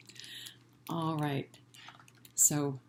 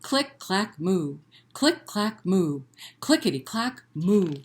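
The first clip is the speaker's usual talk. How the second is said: rhythmic click clack with a big loud moo; read-aloud refrain; typing cow refrain; 2 semitones higher, 4 dB louder than usual. Shown as a demonstration. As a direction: bounce the clicks, belt the moo. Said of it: "here we go".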